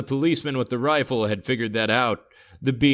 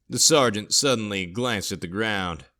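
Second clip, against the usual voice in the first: plain reading voice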